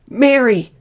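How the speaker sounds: loud, high cry of a name; explosive cry. A narrator's reading. Mother remembering her baby girl, explosive cry. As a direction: let the name burst out from deep inside. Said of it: "The name exploded from deep inside".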